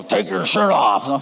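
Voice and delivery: gruff nurse order. Retold or imitated.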